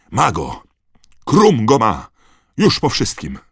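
loud, high triumphant calling; excited call. A narrator's reading. Harry sees Krum holding the snitch, calling out. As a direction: call out the catch in loud bursts. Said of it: "zawołał Harry".

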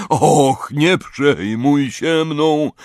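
drawn-out feeble groan, sinking at the end; woeful, slow lament; weak moaning. A narrator's reading. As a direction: moan it out, starting high and fading. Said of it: "jęknęła słabym głosem".

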